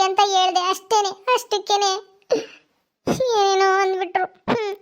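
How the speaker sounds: high weepy neighbour voice; tearful complaining woman. Acted. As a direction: complain through tears, feeling refused.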